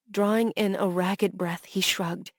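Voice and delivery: ordinary delivery, nothing marked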